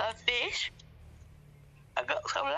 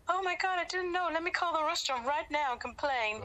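higher, fake-shocked innocent voice; mock innocent surprise; 7 semitones higher than usual. Mocking the vegan woman faking surprise, high fake innocence. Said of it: "she's trying to make out like".

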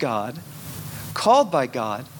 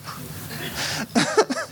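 airy laugh; 4 dB quieter than usual. Retold or imitated. Penny mocking laugh.

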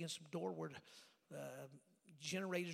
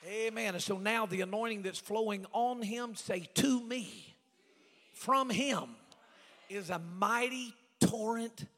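loud, raised, building preacher's delivery; full-force preaching; 5 semitones higher, 18 dB louder than usual. Real, not acted. Preacher honouring the bishop as father in the gospel, full-force preaching. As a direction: build to a ringing pulpit cry.

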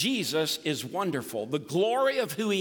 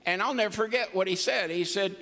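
ordinary delivery

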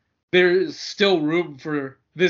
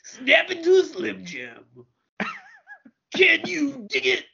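gravelly-free, raised wrestler growl; wrestler impression; 8 semitones higher, slower than usual. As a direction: ham up a pro wrestler's catchphrases. Wrestler catchphrase impression, higher.

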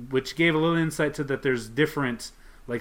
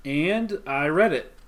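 ordinary delivery

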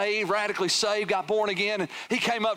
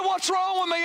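high, baffled protest, imitated; retold protest; 11 semitones higher than usual. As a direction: play a bewildered man insisting, pitched high. Retells man baffled by tears.

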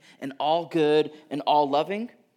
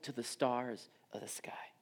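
whispered awe; 4 semitones lower, 12 dB quieter than usual. Retold or imitated. God's hushed speech to Habakkuk.

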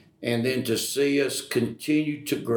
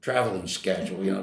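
usual speaking voice

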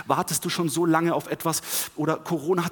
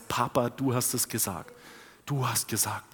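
softer, low-pitched address to a father; quiet trust; 4 semitones lower, 6 dB quieter than usual. Acted. Abraham to his Father, quietly trusting. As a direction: say it softly, as settled trust.

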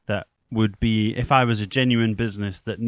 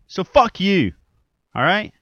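defiant shout; 9 semitones higher, 9 dB louder than usual. Real, not acted. Defiant outburst at absent listeners.

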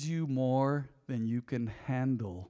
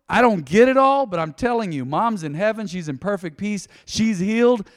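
louder, higher preacher's build; preaching at full force; 7 semitones higher, 9 dB louder than usual. Acted. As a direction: preach it out with conviction. Preaching on loss.

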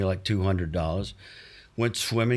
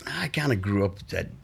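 ordinary delivery

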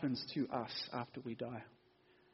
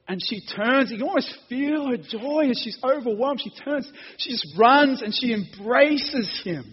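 higher, much louder, swept up in joy; impassioned preaching; 9 semitones higher, 11 dB louder than usual. Acted. Preacher retells Mary running to embrace Jesus, impassioned. Preaches the scene at full force.

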